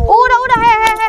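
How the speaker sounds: alarmed shouting. Acted. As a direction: call out to break up a fight.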